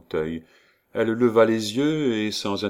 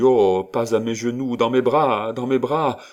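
loud, raised, overcome with feeling; impassioned cry; 4 semitones higher, 6 dB louder than usual. A narrator's reading. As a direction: cry out to embrace a daughter. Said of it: "s'écria l'inconnu". (cri passionné, fort et aigu)

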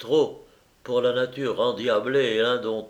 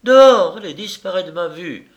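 high, loud cry dropping into a pitched-up command; furious order; 7 semitones higher, 10 dB louder than usual. A narrator's reading. Mistress throwing the baron out, shouted command. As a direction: order him out, exploding on the first word. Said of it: "ordonna-t-elle".

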